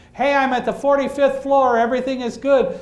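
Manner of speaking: loud, high call down the stairwell; calling out to people below. Retold or imitated. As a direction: shout the floor number out to everyone. David shouting floor numbers.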